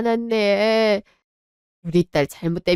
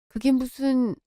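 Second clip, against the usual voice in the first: normal talking voice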